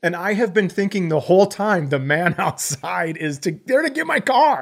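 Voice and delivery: building into an incredulous squeak; worked-up disbelief. Retold or imitated. Frantic retelling of repo scare.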